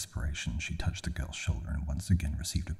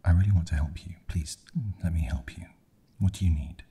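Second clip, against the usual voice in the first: louder, earnest plea to help; pleading; 3 semitones higher, 6 dB louder, faster than usual. A narrator's reading. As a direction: beg them to accept your help. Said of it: "she pleaded".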